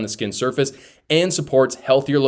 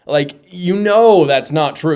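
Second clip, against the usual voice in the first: louder, slow insistence; emphatic; 5 semitones higher, 5 dB louder, slower than usual. Real, not acted. Host scoffing at Crockett, slow and loud. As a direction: drive it home, slow and loud.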